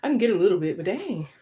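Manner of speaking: winded and panting; breathless, gulping air; out of breath. Real, not acted. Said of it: "so out of breath like this".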